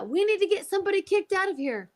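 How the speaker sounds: quick, high-pitched retold alarm; urgent demand. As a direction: imitate his urgent demand in a hurried high voice. Repeats the man's urgent demand.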